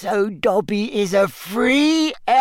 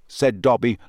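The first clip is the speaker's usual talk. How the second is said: usual speaking voice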